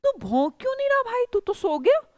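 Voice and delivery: high, fast, scolding donkey voice; donkey's voice. Acted. Donkey scolding the sleeping dog, high and fast.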